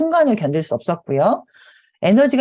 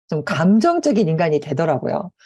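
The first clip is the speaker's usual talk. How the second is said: normal talking voice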